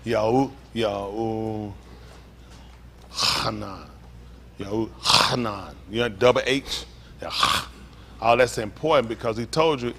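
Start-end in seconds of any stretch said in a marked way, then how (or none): 0.1-5.8 slow, separated Yahu, then Hanan with a long throaty H; careful enunciation. Shown as a demonstration. Teacher demonstrating the distinctive double H, slowly enunciated. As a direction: sound out Yahu, Hanan, rasping the H of Hanan. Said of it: "enunciate it correct".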